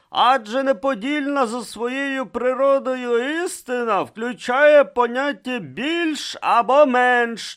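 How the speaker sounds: put-on high, loud irate old man; indignant old philosopher holding forth; furious declaiming. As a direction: lay down the argument in a slow, angry high voice. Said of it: "люто вирячився на дверний отвір".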